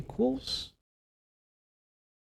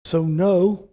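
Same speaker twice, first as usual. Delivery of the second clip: emphatic no; 5 semitones higher, 8 dB louder than usual. Real, not acted. Emphatic denial in sermon.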